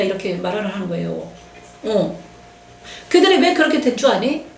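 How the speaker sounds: ordinary delivery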